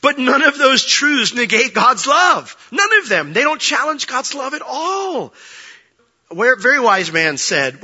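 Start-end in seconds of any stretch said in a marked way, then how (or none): 0.0-5.3 loud high sermon climax; preaching at full force. Acted. Preacher to his church, loud and high. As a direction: drive the point home to the congregation.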